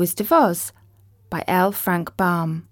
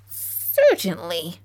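mouse queen voice; slower than usual. A narrator's reading. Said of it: "squeaky little voice".

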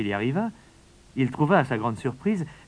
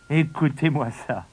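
louder, teasing scorn; mocking brothers; 3 semitones higher, 6 dB louder than usual. Older brothers mock David, louder teasing tone. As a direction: laugh him off as a kid. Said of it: "en se moquant de lui".

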